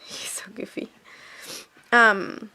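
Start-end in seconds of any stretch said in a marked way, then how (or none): 0.0-0.9 soft fond whisper. Real, not acted. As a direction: breathe it as an aside. Aside about toddler.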